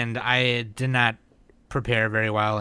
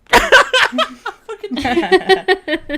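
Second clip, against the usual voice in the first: loud high laugh; 10 dB louder than usual. Real, not acted. Big laugh at joke.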